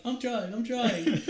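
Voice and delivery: high, loud, slow-stretched retold yell; frantic protest. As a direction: mock-desperate shouting back at his wife. His panicked reply to wife.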